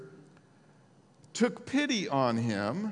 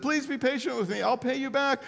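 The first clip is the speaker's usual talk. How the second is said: higher pleading voice; begging; 8 semitones higher than usual. Acted. Fellow servant begs the servant, high mild pleading. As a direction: plead for more time, lightly acted. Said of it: "the fellow servant begged him".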